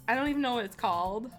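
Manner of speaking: ordinary talk, nothing marked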